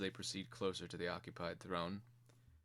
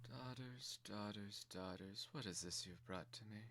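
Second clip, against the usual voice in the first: much softer, sweet yet stern mother; a queen quietly chiding her daughters; quiet but stern; 11 dB quieter than usual. Acted. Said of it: "A sweet, feminine voice, quiet but stern".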